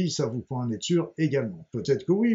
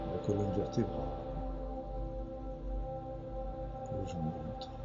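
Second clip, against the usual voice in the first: guided meditation; 5 semitones lower, 9 dB quieter than usual. Acted. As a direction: ease them gently into calm.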